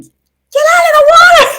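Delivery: high, loud frantic yell; shrieking get out of the water; retold yell. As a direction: scream the warning. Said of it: "Donna was yelling at me".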